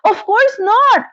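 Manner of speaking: put-on high, slow little girl reply; child voice. A narrator's reading. Dorothy to the witch, high slow childlike reply. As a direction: answer like an innocent child.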